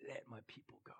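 God calling Moses in a hushed low voice. Retold or imitated. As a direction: speak as God, low and soft. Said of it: "go to that guy and say".

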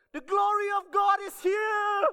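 very high, loud, drawn-out mock proclamation; over-the-top rapturous holler; hammed-up exclamation. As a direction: shout it slowly like an astonished revelation. Parents exclaim over clean baby.

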